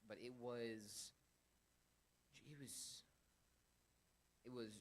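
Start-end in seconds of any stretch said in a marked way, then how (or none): none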